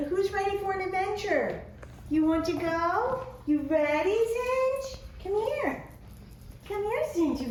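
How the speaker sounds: high, sing-song coaxing voice for the dog. Real, not acted. Owner coaxing her dog Zinj, high sing-song pet talk.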